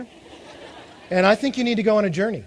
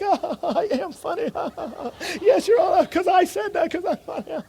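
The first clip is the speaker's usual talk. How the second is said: much higher, hammed-up voice of a vain joker lapping up laughs; smug self-admiring joker; 10 semitones higher than usual. A joker preens after his audience laughs, in a high hammed-up voice. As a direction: ham it up like someone sure they're hilarious, with a laugh. Acts a vain joke-teller basking.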